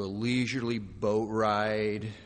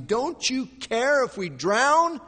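high, loud, drawn-out frightened question; frightened disciples; 11 semitones higher, 7 dB louder, slower than usual. Acted. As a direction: plead loudly as a frightened disciple. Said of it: "who are becoming very frightened".

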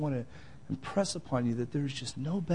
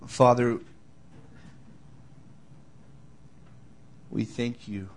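closing prayer. Acted. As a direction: pray humbly before the church. Pastor closes in prayer.

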